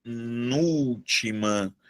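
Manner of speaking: "penúltima" stretched slowly, pe-NÚL-ti-ma, low and soft; split into syllables. Shown as a demonstration. Teacher sounding out "penúltima" for the class, slow syllables. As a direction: draw out each syllable of "penúltima", quietly. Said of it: "Se eu tenho uma palavra".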